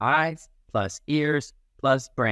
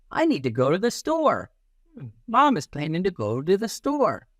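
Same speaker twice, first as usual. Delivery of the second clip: higher, lightly voiced mom then thinking child; a light sing-song storybook voice; character voice; 7 semitones higher than usual. Mom announcing the store trip in a higher voice.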